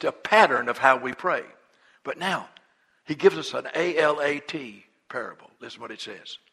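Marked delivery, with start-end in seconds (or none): none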